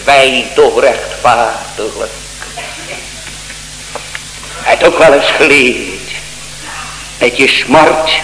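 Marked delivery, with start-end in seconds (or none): 0.0-2.1 humble thief on the cross. Retold or imitated. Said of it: "die zegt".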